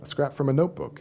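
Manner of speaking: ordinary delivery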